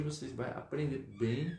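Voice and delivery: normal talking voice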